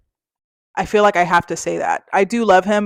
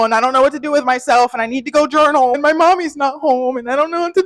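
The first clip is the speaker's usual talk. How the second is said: rising higher and louder into a tearful whine; getting emotional; 6 semitones higher, 5 dB louder than usual. Real, not acted. Moving out in three days, building to a tearful whine. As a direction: let it build until the voice nearly cracks. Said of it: "I'm getting a little emotional".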